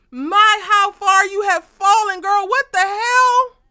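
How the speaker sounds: loud, very high, slow theatrical lament; dramatic dismay. Acted. Recapper lamenting Sabrina's fall, loud and very high. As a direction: mourn her downfall for the crowd. Scolding a TV character.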